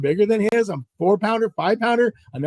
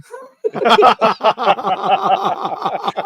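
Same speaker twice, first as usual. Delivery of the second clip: high, loud cackling fit; long laugh; 3 semitones higher, 6 dB louder than usual. Real, not acted. Storyteller cracks up at the partner's luck, real laughter. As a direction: crack up helplessly.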